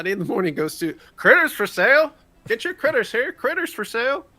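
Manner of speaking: loud, high market hawker call; vendor shout. Acted. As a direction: hawk critters like a street seller. Hawking critters like a vendor.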